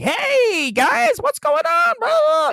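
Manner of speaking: very high fake host voice; squeaky mock TV host greeting; mocking Scott Mance. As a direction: parody of a chirpy entertainment reporter. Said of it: "he's like".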